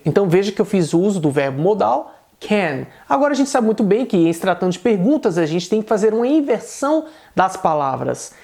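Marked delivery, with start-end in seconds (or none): none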